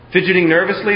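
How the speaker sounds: usual speaking voice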